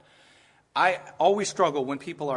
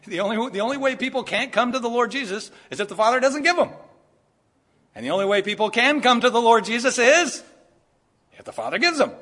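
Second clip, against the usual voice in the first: raised, higher preacher's push; fervent preaching; 6 semitones higher, 7 dB louder than usual. Acted. Pastor pressing the congregation, building fervent preaching. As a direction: drive the point home from the pulpit.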